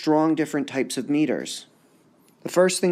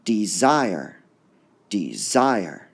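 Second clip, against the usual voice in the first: "Desire" as de-ZIRE, second part lifted; stress on second syllable; 4 semitones lower, much slower than usual. Shown as a demonstration. Teacher sounds out the word, stress on ZIRE in "Desire". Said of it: "we hear the zire part going up".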